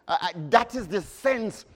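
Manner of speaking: ordinary talk, nothing marked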